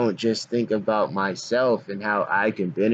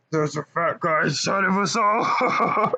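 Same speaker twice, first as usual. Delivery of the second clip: deep Pennywise voice; 8 semitones higher, slower than usual. Pretend. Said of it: "a deep voice Pennywise a little bit".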